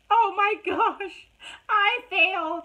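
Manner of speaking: high, loud, slow tearful wail; crying despair. Retold or imitated. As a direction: wail it like the tears are coming. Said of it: "I was just like".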